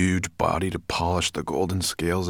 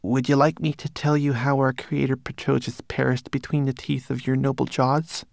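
high-pitched manikin voice; obsequious servant; 8 semitones higher than usual. A narrator's reading. Manikin offering his master another tale, high and servile. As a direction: offer it sweetly to your master.

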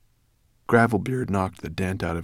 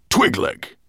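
high, loud call; commanding shout of the name; sharp summons; 13 semitones higher, 7 dB louder than usual. A narrator's reading. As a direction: summon your servant suddenly. Master summons servant abruptly.

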